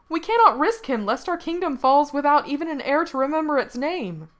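high, loud, urgent plea; crying out. A narrator's reading. Horseman fears for the kingdom's only heir, crying out. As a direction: keep the pitch high and protest urgently. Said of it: "cried another".

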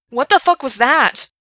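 startled high yell. A narrator's reading. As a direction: shout it out in alarm. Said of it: "Gerard yelled".

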